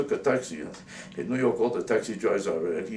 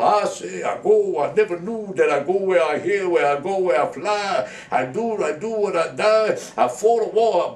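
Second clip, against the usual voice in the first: high, loud mock Southern holler; Southern accent; 7 semitones higher, 6 dB louder than usual.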